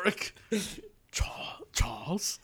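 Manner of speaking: whispered Charles, drawn out slowly; mock Magneto whisper; Magneto impression. Shown as a demonstration. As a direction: breathe the name like a brooding villain. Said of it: "I can do Magneto".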